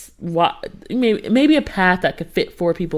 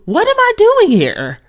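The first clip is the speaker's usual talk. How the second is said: very high, louder wail of regret; panicked outcry; 5 dB louder than usual. Retold or imitated. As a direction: squeal it in mock panic. Panicking on the haunted trail.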